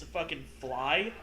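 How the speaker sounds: usual speaking voice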